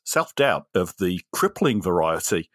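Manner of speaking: New Zealand accent impression; Taiki Wakiti. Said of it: "sorry, that's my New Zealand accent".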